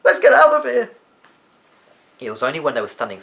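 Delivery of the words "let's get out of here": loud, high frightened yell; terrified boy urging his friend to flee; panicked shout. Acted. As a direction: shout it while already turning to run. Panicked flight from the ruin.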